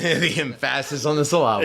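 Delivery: repeats it through a laugh; chuckling while echoing the phrase. Real, not acted.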